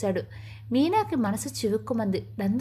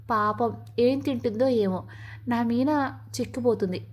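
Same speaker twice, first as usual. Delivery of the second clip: usual speaking voice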